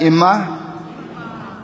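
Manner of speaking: Imma, soft and drawn out; repeat-after-me Imma. Shown as a demonstration. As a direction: say Imma gently as a demo. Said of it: "Can you say that?".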